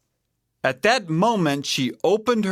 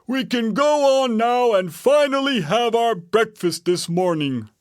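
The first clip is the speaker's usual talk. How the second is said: raised storybook bear voice; Papa Bear; 9 semitones higher than usual. A narrator's reading. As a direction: announce it as the father bear. Papa Bear continues after tag.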